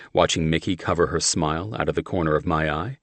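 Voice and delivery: plain reading voice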